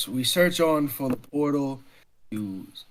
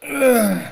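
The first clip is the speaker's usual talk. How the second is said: loud, high stretching groan; effortful groan; 12 semitones higher, 12 dB louder than usual. Acted. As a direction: groan as if stretching a stiff back. Character stretches his lower back.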